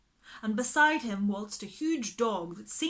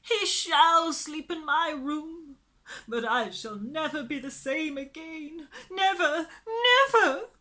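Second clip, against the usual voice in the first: high, loud sobbing resignation; tearful surrender; 8 semitones higher, 7 dB louder than usual. A narrator's reading. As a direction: give in through sobs. Miss Lark tearful.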